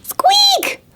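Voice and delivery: very high little mouse squeak; tiny squeak. A narrator's reading. Storyteller makes the tiny noise Owl hears, high squeak. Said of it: "he saw the noise".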